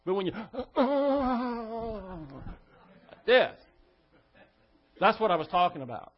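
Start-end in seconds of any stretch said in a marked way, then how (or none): 0.4-2.5 big gaping yawn, higher pitched; demo yawn gasp. Shown as a demonstration. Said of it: "like this, it's all right".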